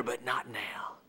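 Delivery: hushed farewell. Acted. Jesus quietly telling his disciples, hushed near whisper.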